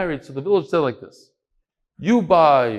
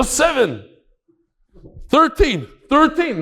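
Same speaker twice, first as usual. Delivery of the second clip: excited number check; 12 semitones higher, 4 dB louder, slower than usual. Retold or imitated. Checking his numbers as they're read.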